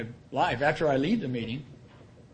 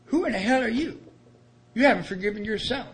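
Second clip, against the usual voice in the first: harsh scolding; 6 semitones higher than usual. Retold or imitated. Sponsor scolding him harshly.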